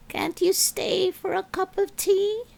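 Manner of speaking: a hint of a concerned older lady asking; gentle older lady. A narrator's reading. Missus Willow voiced.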